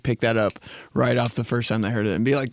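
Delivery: normal talking voice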